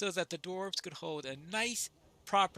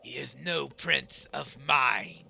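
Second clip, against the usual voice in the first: low, slow, loud refusal; defiant dwarf; 4 semitones lower, 8 dB louder, slower than usual. Acted. Dwarf rejecting the prince, low defiant voice. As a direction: refuse heavily and loudly.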